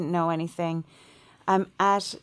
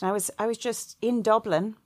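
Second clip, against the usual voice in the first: normal talking voice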